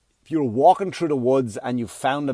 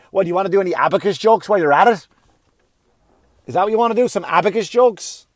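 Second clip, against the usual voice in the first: yelling; 4 semitones higher, 5 dB louder, faster than usual. Real, not acted. Comic yelling at older comics about abacus jokes, loud rant. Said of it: "Why are you yelling".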